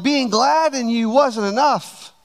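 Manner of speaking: ordinary talk, nothing marked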